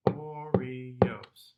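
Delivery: "Oreos" in three even beats; three syllables. Shown as a demonstration. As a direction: chant "Oreos" as O-re-os. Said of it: "in two syllables rather than three".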